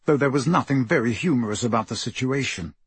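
plain reading voice

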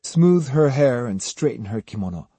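plain reading voice

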